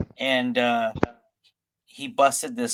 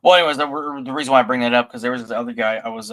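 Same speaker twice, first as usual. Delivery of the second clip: normal talking voice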